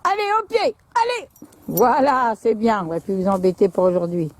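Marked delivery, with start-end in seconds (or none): 0.0-1.3 high, sing-song call to a dog; coaxing dog. Real, not acted. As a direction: coax the dog in, much higher.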